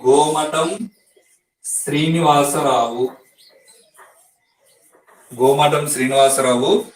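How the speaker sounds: name spelled out slowly, word by word; slow dictation. Real, not acted. (నెమ్మదిగా, విడదీసి చెప్పడం)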